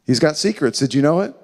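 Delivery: ordinary talk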